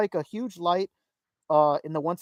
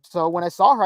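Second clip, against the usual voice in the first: ordinary delivery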